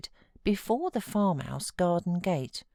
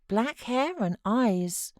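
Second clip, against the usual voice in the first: put-on musing boy; 6 semitones higher, slower than usual. A narrator's reading. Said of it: "mused Linton".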